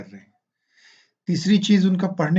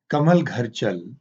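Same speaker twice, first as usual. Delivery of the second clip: slow, even reading of कमल घर चल; slow natural phrase; 2 semitones lower, slower than usual. Shown as a demonstration. Teacher modelling natural reading, slow and even. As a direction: say it as one easy phrase. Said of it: "जैसे बोलते हैं".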